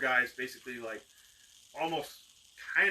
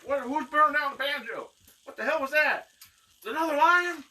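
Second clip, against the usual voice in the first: loud, high-pitched bewildered hollering; alarmed shouting; 14 semitones higher, 8 dB louder than usual. Acted. Men rushing out of the bar with sticks, alarmed shouts. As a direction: yell out in indignant confusion.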